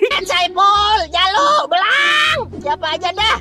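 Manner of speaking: high drawn-out call for help. Real, not acted. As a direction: call across the house, slowly. Memanggil-manggil dari kamar mandi. (teriak memanggil dari kamar mandi)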